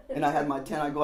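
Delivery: usual speaking voice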